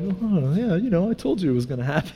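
soft, offhand feigned cool; calm and collected. Retold or imitated. Youth pastor to rescued students, put-on calm. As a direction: play it down as if never worried. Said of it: "calm and collected".

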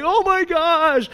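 high, drawn-out mock panic; freaking out. Kid being towed behind the boat, mock freak-out. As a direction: imitate a panicking kid, stretching it out. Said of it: "he's freaking out".

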